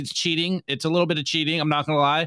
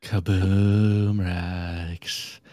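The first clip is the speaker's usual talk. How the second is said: very low, drawn-out sponsor name; playful deep slow-motion echo; mock announcer drone; 9 semitones lower, much slower than usual. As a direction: stretch it out slowly, way down low. Echoes sponsor jingle in mock voice.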